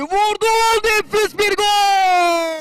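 long, drawn-out goal roar, high and loud; screaming football commentator. Real, not acted. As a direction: stretch out the goal call at full voice. Goal scored in live commentary.

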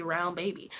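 ordinary talk

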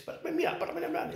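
mock panicked stammering. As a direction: sputter it out in a high panic. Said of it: "he just panicked and started speaking Spanish".

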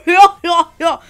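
loud, high mocking laugh; hammed-up laugh in a friend's voice; exaggerated goofy laugh, loud; Connor's laugh. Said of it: "I'm Connor".

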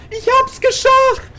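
very high, slow elated cry; ecstatic. Real, not acted. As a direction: stretch it out in ecstasy. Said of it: "repeated in rising joy".

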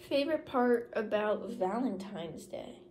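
talking around a straw; straw in mouth. Real, not acted. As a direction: lips gripping straw. Seen 0: Pink straw held in mouth corner while she talks.

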